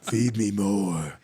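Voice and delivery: slow, drawn-out demand for food; ravenous glutton. Acted. Comic recalling his eighty pounds heavier self, ravenous glutton voice. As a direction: groan it out like an insatiable eater.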